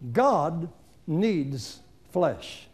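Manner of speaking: very slow, weighty; slow emphasis. Real, not acted. Preacher stating his central point slowly. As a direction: let it land word by word.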